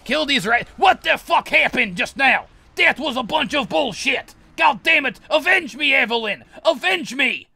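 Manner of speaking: shrieking very high and loud in fury; real furious yelling, voice cracking high; raging scream. Real, not acted. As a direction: lose it completely at the game. Sudden death mid-fight, raging.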